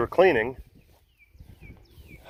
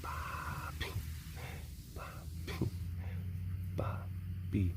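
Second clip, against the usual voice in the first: whispering; 3 semitones lower, 8 dB quieter, much slower than usual. Real, not acted. Calling a pet softly.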